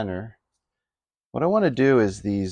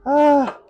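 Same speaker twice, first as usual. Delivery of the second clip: loud cry of pain; 8 dB louder than usual. Acted.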